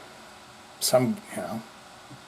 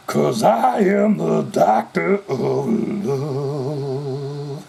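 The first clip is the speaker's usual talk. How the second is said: very slow, drawn-out rock-star line; much slower than usual. Retold or imitated. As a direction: savour each word like a cheesy stage boast. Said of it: "And then they go".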